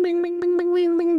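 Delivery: high pitched bing bing bing; mimicking ping pong back and forth. Shown as a demonstration. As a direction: bounce each bing up high like a ball. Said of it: "ping pong going bing".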